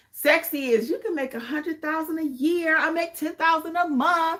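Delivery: a light, hammed-up flashy money guru; mock rich boaster. Notary coach mocking get-rich hype, lightly hammed-up boast. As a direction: brag like a showy influencer, half-mocking.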